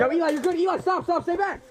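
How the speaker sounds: urgent shout. Real, not acted. Urgently stopping teammate under fire.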